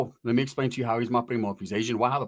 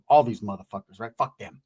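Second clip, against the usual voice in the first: ordinary talk, nothing marked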